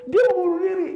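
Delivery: high, loud burst of disbelief; astonished exclamation. Retold or imitated. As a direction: exclaim it in retold shock, pitch leaping up. Astonished at stranger's suicide plan.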